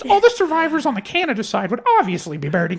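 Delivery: high-pitched, loud mock Canadian accent. Third grade [name] solving the riddle, mock Canadian accent. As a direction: ham up a Canadian kid reasoning it out. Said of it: "that was my Canadian accent".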